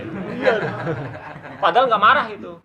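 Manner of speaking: real laughter, high and loud; laughing aloud while telling his boarding-school story. Real, not acted. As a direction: laugh it out between words. Laughing over the dialect joke.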